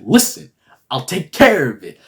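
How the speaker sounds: put-on urgent appeal; mock pleading. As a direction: insist you have it handled. Acted pleading with Khonshu.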